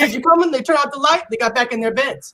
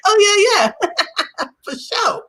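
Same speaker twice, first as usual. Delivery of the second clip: trying to sound cool; 8 semitones higher than usual. Retold or imitated. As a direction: fake easy confidence, broken by a laugh. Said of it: "And I was like".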